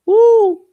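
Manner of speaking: loud, sudden high "woo"; high whoop. Real, not acted. Vlogger reacting to the mess, high whoop. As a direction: whoop up into falsetto. Whoops after acted quote.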